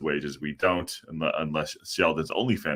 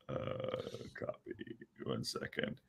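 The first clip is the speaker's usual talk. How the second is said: whispered aside; 6 semitones lower, 16 dB quieter, slower than usual. Real, not acted.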